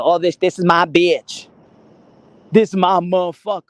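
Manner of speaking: loud, emphatic put-on boast; mock boasting. Woman showing off her man to others, loud mock boast. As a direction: play up the possessive brag, loudly.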